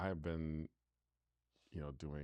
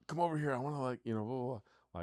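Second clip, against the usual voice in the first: eager grabby voice; 8 semitones higher, 6 dB louder, much faster than usual. Retold or imitated. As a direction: pitch jumps high, louder, mock eager pleading. Mocking his old grabby impulse.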